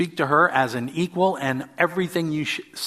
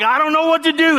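much higher, louder, frantic cry; flustered driver at a loss, pitched way up; panicked; 13 semitones higher, 6 dB louder, faster than usual. Retold or imitated. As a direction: squeal it out in helpless panic. Panicked self quoted while driving.

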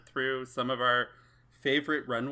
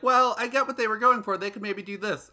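mock diplomatic; 9 semitones higher, 5 dB louder than usual. Imitating herself, exaggerated diplomatic tone.

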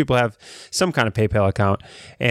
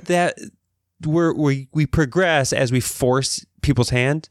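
usual speaking voice

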